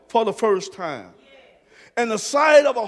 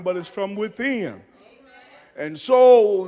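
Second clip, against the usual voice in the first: ordinary talk, nothing marked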